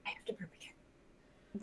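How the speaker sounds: slow unvoiced confession; whisper. Real, not acted. A player whispers to the group that she has to burp again. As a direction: whisper it sheepishly. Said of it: "whispered aside, unvoiced".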